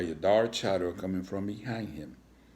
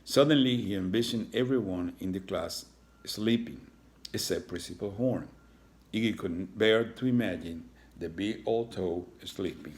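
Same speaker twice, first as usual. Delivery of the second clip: usual speaking voice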